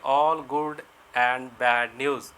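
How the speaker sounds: each word spaced out, very slow; slow dictation. Shown as a demonstration. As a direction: dictate it slowly so students can write. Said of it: "slow dictation for students". (धीरे-धीरे लिखवाना)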